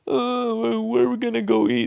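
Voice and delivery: very high, fast, whiny mocking voice; whiny complainer. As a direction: mimic the developers whining about lunch. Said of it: "constantly complaining about".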